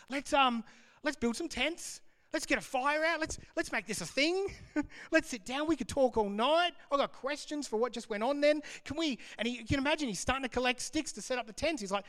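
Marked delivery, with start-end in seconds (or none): 0.1-9.2 high-pitched, tumbling eagerness; excited Peter. Acted. Peter proposes camping all night with his heroes, excited. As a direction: play an overexcited disciple pitching plans. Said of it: "He's excited".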